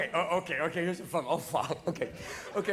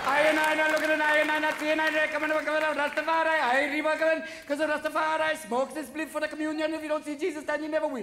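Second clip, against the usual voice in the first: high, rapid Rastafarian chant; Rastafarian patois; 7 semitones higher, 4 dB louder than usual. Acted. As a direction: rattle it off high in a Jamaican Rasta accent. Acted Rastafarian chant in routine.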